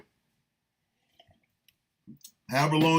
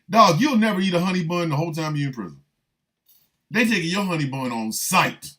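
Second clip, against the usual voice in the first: ordinary talk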